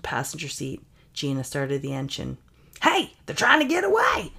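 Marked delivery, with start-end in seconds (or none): 2.8-4.3 high-pitched holler; calling out alarm. Acted. Hunter alerting the mob to a getaway, high urgent holler. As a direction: shout it to the rest of the mob.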